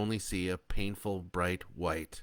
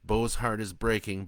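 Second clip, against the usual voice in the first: plain reading voice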